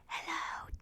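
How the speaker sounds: voiceless breathy ASMR hello; plain ASMR whisper. Shown as a demonstration. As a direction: whisper it softly, like a basic ASMR intro. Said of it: "just, like, normal, like".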